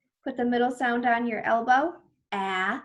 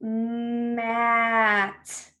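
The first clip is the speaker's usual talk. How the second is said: drawn-out blending. Shown as a demonstration. Teacher blending sounds for children, Mat drawn out. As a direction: stretch every sound of Mat slowly.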